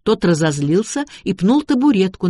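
plain reading voice

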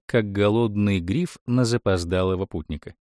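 usual speaking voice

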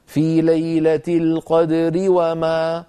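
slow recitation, القدر joined to وما with a full light ri; connected light ra. Shown as a demonstration. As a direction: read on through القدر into وما, thin ر with full i. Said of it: "فهكذا في الوصل".

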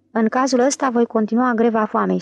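plain reading voice

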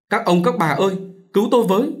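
high, loud appeal to onlookers; calling for help. A narrator's reading. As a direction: cry out to be saved. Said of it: "Cô kêu". (kêu cứu thất thanh)

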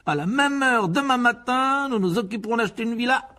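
high, excited plan swelling to a laugh; domineering pompous uncle. Acted. Uncle planning the deal and a villa, bossy turning gleeful. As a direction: lay down orders, then gloat grandly.

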